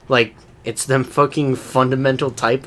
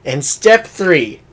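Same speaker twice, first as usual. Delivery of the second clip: loud, building presenter reveal; 6 dB louder than usual. Retold or imitated. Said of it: "it's just like".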